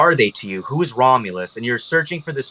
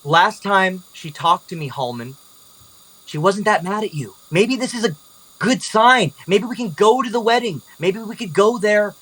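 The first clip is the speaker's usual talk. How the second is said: higher-pitched, hopeful coaxing; pleading young-woman character; 7 semitones higher than usual. Acted. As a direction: plead the case in a lifted voice. Game master voices Olivia pleading.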